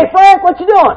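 high, loud, cheery "Hey, Frank"; brother's cheery greeting. Retold or imitated. Brother phoning with a loud, high hello. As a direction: toss it up bright like a brother calling.

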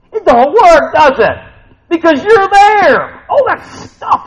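sudden loud, high outburst; emphatic exclamation. Real, not acted. Preacher puncturing the dream of getting away, loud and emphatic. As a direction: break the calm with a loud, high punchline. Bursts the calm escape just acted.

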